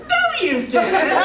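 loud, high, laughing no, slowly; laughing loud disbelief. Retold or imitated. Wife laughing loud at husband's claim, disbelieving. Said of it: "I said".

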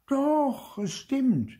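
put-on very high child's protest; high-pitched squabbling retort; stubborn insistence. A narrator's reading. Clara insists in quarrel.

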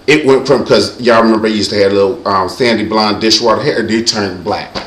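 ordinary delivery

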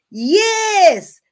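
sudden high loud shout of joy; a joyful cry of yes; excited yes. Real, not acted. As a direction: burst out with delight. Dramatic reveal of being chosen.

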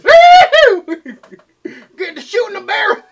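hammed-up loud Southern accent. Mocks Southern accent with whoops.

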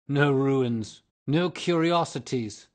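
loud, high scornful echo; ghost echoing scornfully. Acted. Ghost mocking Virginia's words, loud and high. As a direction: throw her words back, loud. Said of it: "answered the ghost".

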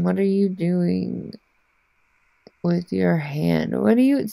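ordinary delivery

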